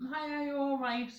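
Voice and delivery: high-pitched greeting to a stranger; friendly hello. Shown as a demonstration. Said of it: "say hi to someone, be like".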